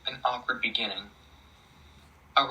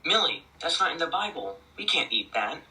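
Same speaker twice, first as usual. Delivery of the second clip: higher, louder objection at the start; raised voice; 6 semitones higher, 5 dB louder than usual. Acted. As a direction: protest with voice raised. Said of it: "raising his voice".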